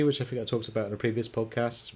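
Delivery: ordinary talk, nothing marked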